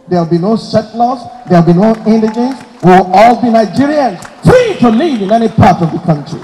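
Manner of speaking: building to a loud oratorical shout; ringing, full-voiced rally cry; rousing declaration. Real, not acted. Rallying climax of a political speech.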